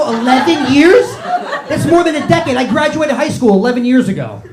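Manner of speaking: high, loud incredulous outburst; loud disbelief. Real, not acted. Comic reacts to an audience member's answer, loudly incredulous. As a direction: blurt it out, aghast, drawing out the first words.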